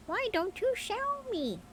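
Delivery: very high, slow mynah bird voice. A narrator's reading. Mynah coaxing its owner, high bird voice. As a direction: chirp it out like a talking bird. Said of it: "said the mynah".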